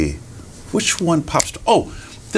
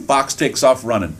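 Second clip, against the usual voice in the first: plain reading voice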